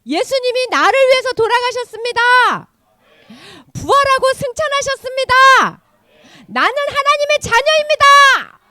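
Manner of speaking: high, loud, fervent proclamation; preacher's declaration. Shown as a demonstration. Teacher prompting children to answer amen, fervent proclaiming. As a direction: proclaim each truth to draw an amen. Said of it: "전사 한번 해볼 테니까 아멘 하세요".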